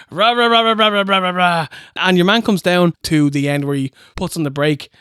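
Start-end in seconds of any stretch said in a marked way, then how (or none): 0.1-1.7 mock motorbike rev; engine revving. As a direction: rah the engine playfully.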